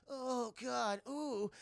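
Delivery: high, hammed-up groaning; mock pain. [name] struggles to stand up, high mock pained groan. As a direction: moan as if badly hurt, half-hearted. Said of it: "she's in pain and stuff".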